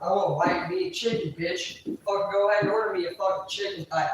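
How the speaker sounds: usual speaking voice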